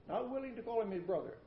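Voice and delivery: ordinary talk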